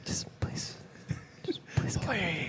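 mock desperate whisper; giggly prank whisper. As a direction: whisper the plea playfully. Joking plea, whispered.

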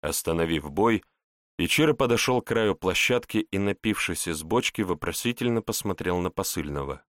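ordinary delivery, nothing marked

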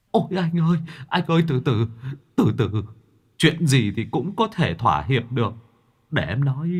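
a nervous ghost bargaining; placating ghost. A narrator's reading. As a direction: ghost hurrying to calm Mạc down.

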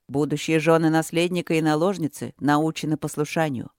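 ordinary delivery, nothing marked

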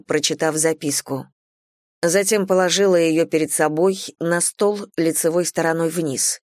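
plain reading voice

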